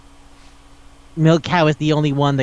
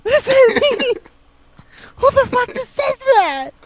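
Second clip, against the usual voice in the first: much higher, squeaking through laughter in disbelief; real laughing disbelief, pitched way up; incredulous laughing squeal; 13 semitones higher than usual. Real, not acted. Disbelief at film line.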